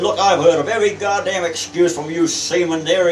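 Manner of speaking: captain berating a seaman, loud; angry captain. Retold or imitated. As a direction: retell a loud dressing-down. Captain berates young seaman for excuse.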